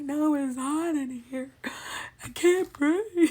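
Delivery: high, loud mock panic; panicked protest. Shaking patient answers staff on the scan bed, panicked protest. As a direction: blurt it, climbing to a drawn-out wail.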